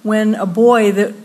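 ordinary talk

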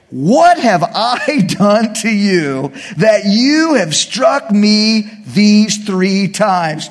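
a very slow, strange put-on donkey voice; talking donkey voice. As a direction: drag out the donkey's plea, hurt and puzzled. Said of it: "and she said to Balaam".

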